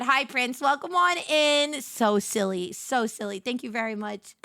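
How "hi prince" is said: normal talking voice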